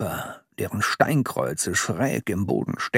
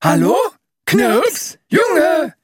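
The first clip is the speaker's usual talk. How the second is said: calling out; 6 dB louder than usual. A narrator's reading. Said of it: "riefen sie gleichzeitig ins Innere".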